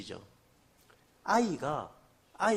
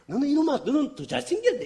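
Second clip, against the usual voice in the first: higher, louder teasing delight; playful praise; 11 semitones higher, 10 dB louder than usual. Retold or imitated. Preacher praising the adopted boy, teasing and delighted, loud and high. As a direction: tease the kid joyfully. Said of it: "애들이 너무 좋아갖고 막 웃는 거예요".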